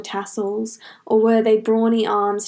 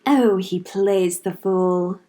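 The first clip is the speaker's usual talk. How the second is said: slow, mocking tease; teasing; 2 semitones lower, slower than usual. A narrator's reading. The warlord mocks Link to his minions, slow teasing tone. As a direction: savor the mockery, drawn out. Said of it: "the menace teased".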